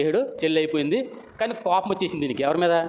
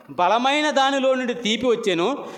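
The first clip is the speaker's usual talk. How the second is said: solemn riddle recitation; 7 semitones higher than usual. Acted. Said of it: "అన్నాడు".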